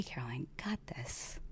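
breathy whispered pep talk. Pretend. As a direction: whisper it to yourself. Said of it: "whispered self-encouragement".

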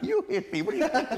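incredulous retort. Retold or imitated. Guest retells answering the drunk driver, laughing in disbelief. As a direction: throw it back at him in disbelief. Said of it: "I'm like".

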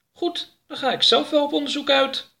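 very high, resolute alien voice; decide it yourself, chin up; determined alien Oroka. Acted. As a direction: Oroka announces he will explore alone.

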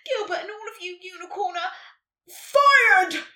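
building to a huge high shout; booming. A narrator's reading. Santa sacking the unicorns, booming. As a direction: boom out the final word. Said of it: "Santa boomed".

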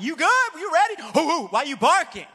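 loud, high, pumped-up morning hype; hyped gym greeting. Retold or imitated. Friend greeting him loudly at the gym, pumped-up shout. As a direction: bellow it like a hyped friend grabbing you. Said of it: "Pick him up. Throw him around".

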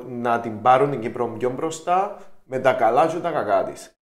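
normal talking voice

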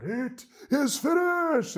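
high, slow, dramatic cry; loud dramatic shout. Retold or imitated. Preacher imitating film depictions of the cross, loud dramatic shout. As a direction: belt it out like a movie Jesus on the cross. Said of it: "in a loud, dramatic voice, shouts out".

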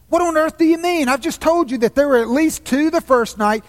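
put-on high, disbelieving boy's outburst; astonished boy. A narrator's reading. Shasta argues with the voice, astonished and high.

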